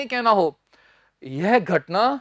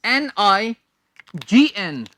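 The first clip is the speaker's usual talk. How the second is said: slow spelling; slower than usual. Shown as a demonstration. Teacher slowly spelling for students to note down. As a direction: slowly spell each letter out. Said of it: "students note कर लीजिए".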